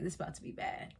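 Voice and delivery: whispered, under the breath; hushed warning. Retold or imitated. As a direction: warn softly that trouble is coming. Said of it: "Rolly's like".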